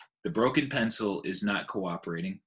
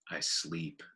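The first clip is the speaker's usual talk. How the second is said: softer, lower drowsy answer; sleepy mumble; 5 semitones lower, 8 dB quieter than usual. Acted. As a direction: answer half asleep, eyes shut. Sleepy Bob, eyes closed.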